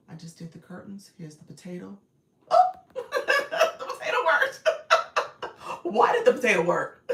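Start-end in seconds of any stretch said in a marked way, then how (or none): 2.5-5.8 loud delighted laughter through the words; laughing out loud. Real, not acted. Potato effect unexpectedly works. 5.8-7.1 loud, laughing question; giggly, disbelieving question. Real, not acted. Potato effect unexpectedly works. 7.1-7.1 loud delighted laughter through the words; laughing out loud. Real, not acted. Potato effect unexpectedly works.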